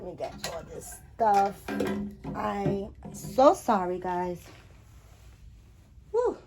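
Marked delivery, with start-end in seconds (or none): none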